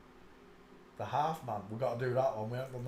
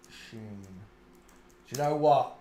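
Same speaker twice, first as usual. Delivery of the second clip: ordinary talk, nothing marked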